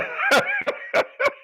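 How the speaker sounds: high wheezing laugh; helpless high-pitched giggle; shrieking laugh. Real, not acted. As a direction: laugh so hard it squeaks. Laughing hard at comment.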